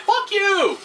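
high, furious shout; angry yell. Real, not acted. Gamer yelling at a monster, enraged. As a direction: spit it at the enemy.

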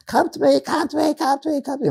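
high, mock pleading cry; imitated screaming crowd. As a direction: call out like a desperate crowd. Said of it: "people are screaming".

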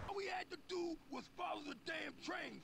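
high, breathy quoted line; character voice. Retold or imitated. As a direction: play the annoyed sidekick.

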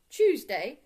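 loud, emphatic confirmation; firm. A narrator's reading. Mister Brown correcting Paddington on the day, firm. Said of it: "said Mister Brown firmly".

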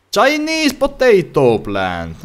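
high, very slow item name read; drawn-out announcer voice. Acted. Player reads the scanned plant name in an announcer voice. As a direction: stretch it out like a game announcer.

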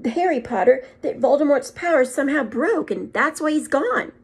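barely holding together; trembling voice. A narrator's reading. Professor tells Dumbledore about Harry, voice trembling. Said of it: "voice trembled as she went on".

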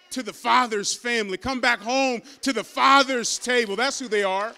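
building preaching, slowing on the last line; rising preaching. Acted. Pastor calling the lost home, rising preaching. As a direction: swell up and lean on lost.

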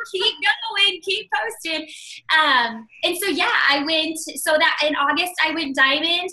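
normal talking voice

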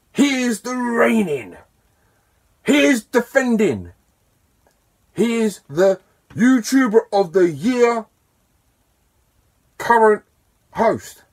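loud, high-pitched ring introduction; wrestling announcer, Paul Heyman. Acted. Introducing the reigning YouTuber of the year host, wrestling announcer style. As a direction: belt it out like a hammed-up wrestling hype man. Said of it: "in my best wrestling tone".

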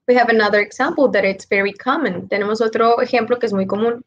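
ordinary talk

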